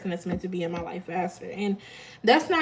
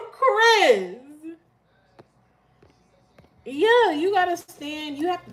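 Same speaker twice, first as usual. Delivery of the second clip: calling out; 7 semitones higher, 3 dB louder, slower than usual. Real, not acted. Calling out a viewer.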